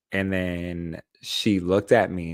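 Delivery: ordinary talk, nothing marked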